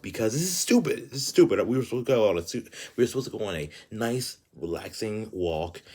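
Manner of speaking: indignant outburst while retelling; exasperated venting. Retold or imitated. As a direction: vent it fast, pitch jumping up. Exasperated venting about the hike.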